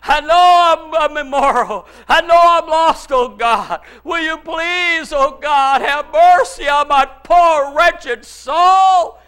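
high, loud, drawn-out acted weeping; wailing plea. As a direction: wail like a desperate sinner begging God. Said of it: "He's weeping, he's wailing, he's crying".

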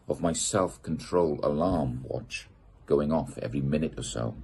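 plain reading voice

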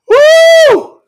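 loud high-pitched long "woo"; joyful whoop. Real, not acted. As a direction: whoop out in real elation. Overcome preacher whoops for joy.

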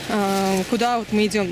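ordinary delivery

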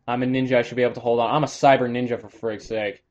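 normal talking voice

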